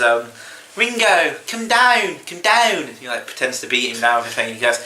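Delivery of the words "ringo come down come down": high-pitched Beatle calling out, mock-impression; Beatle on drugs. Podcast guest retelling a comedian's [name] routine, drugged Beatle impression. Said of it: "does an impression of him on drugs".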